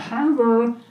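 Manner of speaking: put-on high, slow little-kid voice; hammed-up childish alter, slow and high; mock child voice.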